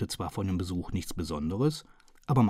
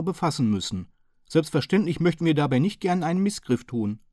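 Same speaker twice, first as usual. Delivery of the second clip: higher, louder, businesslike Hearson; businesslike brevity; 7 semitones higher, 4 dB louder than usual. A narrator's reading. Hearson putting his question to the chief inspector, businesslike and higher. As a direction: get to the point, briskly and officially. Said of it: "mit geschäftsmäßiger Knappheit".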